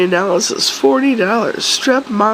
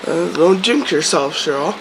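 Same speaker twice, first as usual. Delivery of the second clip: ordinary talk, nothing marked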